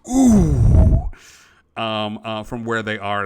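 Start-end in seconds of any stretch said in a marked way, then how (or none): 0.1-1.1 loud high "ooh" at the slap; shocked bystander's ooh; bartender's ooh. A narrator's reading. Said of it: "the bartender just goes".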